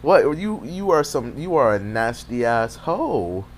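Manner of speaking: stunned yelp turning into a slow put-down; shocked outburst. Retold or imitated. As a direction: burst out in outraged disbelief. Disgusted at him spitting on ball.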